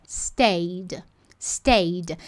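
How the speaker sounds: "Stayed" said as one syllable, with no E sound; correct pronunciation. Shown as a demonstration. Teacher shows students the right way to say "Stayed". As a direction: clip "Stayed" short, no vowel before the D. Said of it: "mostra qual que é a pronúncia correta".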